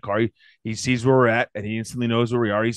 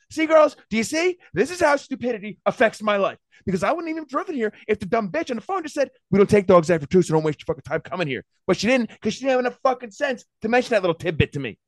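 ranting; 11 semitones higher than usual. Real, not acted. Dad vents to his daughters about the dog daycare, fired-up rant. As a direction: let rip at the stupidity, fired up. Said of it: "now I'm off".

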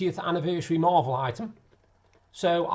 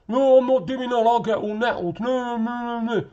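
higher, whiny put-on refusal; mocking imitation; 5 semitones higher, 5 dB louder than usual. Litterer refusing to fetch his can, mockingly imitated. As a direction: mimic him grumbling noes, running them together.

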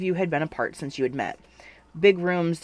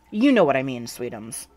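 chiding; 4 dB louder than usual. A narrator's reading. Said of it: "Donnie chided".

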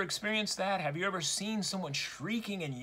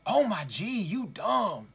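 lightly voiced girl calling someone dumb; mock child yelling; 2 semitones higher, slower than usual. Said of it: "calling them dumb in an even louder voice".

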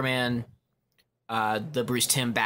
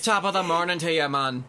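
put-on raised-pitch botched Irish accent; Irish gone Jamaican; 5 semitones higher than usual. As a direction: try Irish, slide into Jamaican. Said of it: "try to do Irish and they sound Jamaican".

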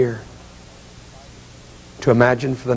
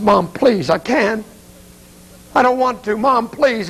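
high-pitched retold begging, rising in distress; desperate pleading; 9 semitones higher, slower than usual. Son begs mother at the casket, desperate high plea. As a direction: beg like a son who cannot face it.

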